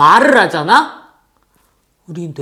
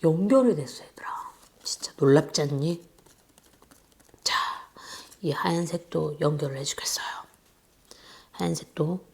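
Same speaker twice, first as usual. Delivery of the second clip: soft, hushed voice while working; whispering; 4 semitones lower, 11 dB quieter than usual. Real, not acted. Teacher narrating craft to children, hushed whisper. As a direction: murmur in quiet focus.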